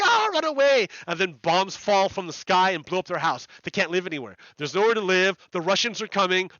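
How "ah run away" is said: high, loud mock panic as he voices fleeing people; panicked cry. Ukrainians fleeing the Russian army, a hammed-up panicked shout. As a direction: yelp it out like someone running from bombs. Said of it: "all these Ukrainian people are like".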